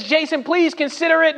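high-pitched mock plea; lightly acted begging of a friend; pleading.

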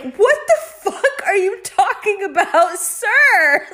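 high, loud, laughing through the question; can't believe what you're hearing and crack up; high-pitched incredulous laugh-talk; laughing disbelief. Retold or imitated. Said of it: "these kids are like".

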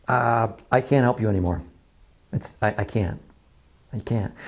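soft, low and spent; quiet giving up. Acted. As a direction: let the words fade out in defeat. Acted saying I can't help.

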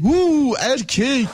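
high drawn-out teasing coo; mock surprise. Host repeats the teasing coo.

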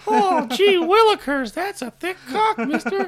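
put-on very high, slow gee-whiz kid; squeaky, wide-eyed innocent kid; wholesome 1950s kid. Hammed-up gushing over thick penis.

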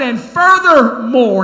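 very loud, slow, drawn-out and high; aggravated. Retold or imitated. Pastor leaning over his desk at a council member, aggravated. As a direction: boom it out to press the rebuke. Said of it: "'cause I was aggravated".

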